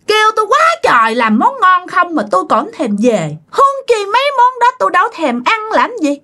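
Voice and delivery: high, disdainful ghost voice; scornful spirit. Retold or imitated. Spirit scorns its mother's cooking, high and dismissive. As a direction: turn up your nose in a high voice.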